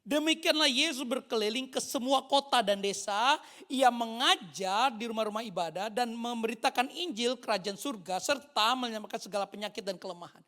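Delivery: loud, emphatic Bible reading; scripture proclamation. Real, not acted. Preacher reads the verse to congregation, loud proclamation. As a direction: proclaim the verse at full volume.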